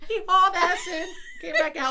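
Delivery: laughing, high and loud. Real, not acted. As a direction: laugh through the telling. Both laughing at story.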